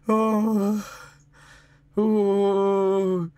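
ordinary talk

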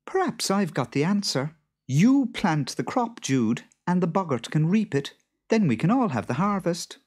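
high-pitched voice of the wife; wife brokering a deal; farmer's wife. A narrator's reading. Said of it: "Then Beth said".

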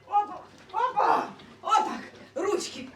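loud, high playful whoops; exclaiming while lifting. Real, not acted. Mama lifting her dog into her arms, excited whoops. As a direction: cheer each heave as you hoist the pet.

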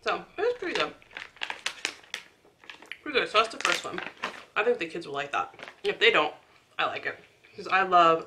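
talking with food in mouth; eating between words; chewing while talking. Real, not acted. Seen 0: Holds snack at her mouth, biting and chewing between phrases.

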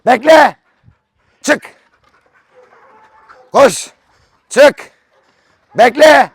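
loud, high barked commands; shouted commands. Real, not acted. Shouting commands up at his flying pigeons, loud and high. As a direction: call the orders up to the flock.

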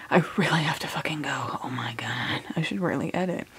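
stressed whisper about leaving. Real, not acted. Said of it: "I'm sorry I'm whispering".

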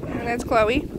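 ordinary talk, nothing marked

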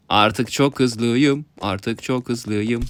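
very slow, drawn-out words; playful slo-mo delivery; slow-motion speech. Pretend. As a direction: stretch every word like a slowed-down replay. Playful slow-motion boast in game.

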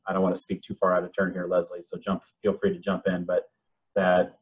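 usual speaking voice

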